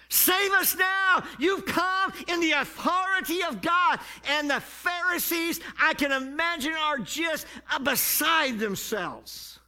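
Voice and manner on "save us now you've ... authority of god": high loud acclaim; crowd's shout. Retold or imitated. The crowd acclaims Jesus on the road, high shout. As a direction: cry it out like the cheering crowd.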